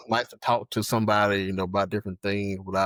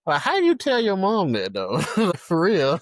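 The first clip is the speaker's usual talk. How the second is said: high, loud and incredulous with laughs; laughing disbelief; 7 semitones higher, 4 dB louder than usual. Real, not acted. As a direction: protest through real laughter at the absurdity. Laughing disbelief at confronting his mother.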